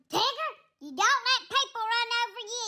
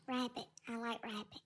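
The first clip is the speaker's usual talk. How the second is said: much lower, much softer voice; low, soft worried pet voice; timid cat Tigger; 9 semitones lower, 13 dB quieter than usual. Acted. Tigger answers.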